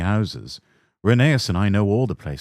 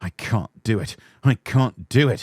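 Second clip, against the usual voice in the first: grumbling; 3 semitones higher than usual. Acted. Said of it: "he grumbled".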